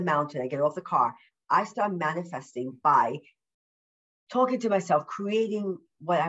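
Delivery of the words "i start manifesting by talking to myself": usual speaking voice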